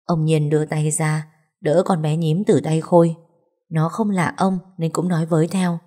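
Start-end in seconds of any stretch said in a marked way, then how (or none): none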